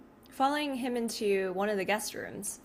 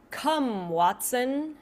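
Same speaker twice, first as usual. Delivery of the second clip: put-on crisp British accent, a bit raised; 3 semitones higher, 4 dB louder, much slower than usual. Disembodied voice from the ceiling, British accent. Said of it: "in, like, a British accent".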